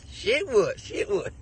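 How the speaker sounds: laughing. Retold or imitated. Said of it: "you call me Shitwood".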